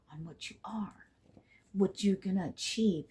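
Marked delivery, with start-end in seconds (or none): 0.1-1.1 soft breath of a whisper; whispered in awe. Real, not acted. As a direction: let it fall to a whisper. Moved, hushed.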